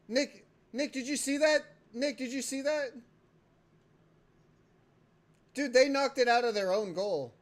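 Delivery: loud, high, insistent; calling out to Nick. Real, not acted. Demanding Nick saw the own goal, loud calling out. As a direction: get Nick's attention, call it over.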